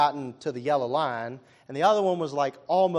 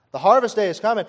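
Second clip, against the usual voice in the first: "harvest" high and loud, rising; loud proclaimed repeat; 7 semitones higher, 10 dB louder, faster than usual. Acted. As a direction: shout the promise of harvest. Repeated line building to climax.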